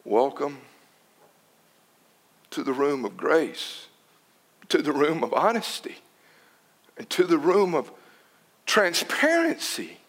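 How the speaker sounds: hushed tender welcome; soft welcome. Acted. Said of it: "a person looks and says".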